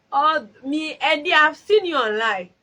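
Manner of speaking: high, loud excited greeting; excited call-out. Real, not acted. Vlogger spotting a viewer online, calling out excitedly. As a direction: call out to a viewer you spot.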